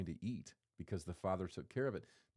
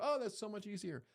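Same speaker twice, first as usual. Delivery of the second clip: higher, delighted oh of relief; relieved delight; 11 semitones higher than usual. Retold or imitated. People discovering a life hack, delighted and higher. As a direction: sound pleased at a clever trick. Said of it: "We go".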